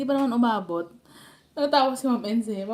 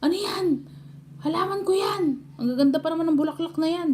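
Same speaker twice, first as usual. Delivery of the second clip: higher mock scolding, fast at the end; imitating furious mother; 7 semitones higher than usual. Mother scolding daughter for pulling her flowers, high mock fury. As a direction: rant like a mother whose flowers were pulled. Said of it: "Sabi niya".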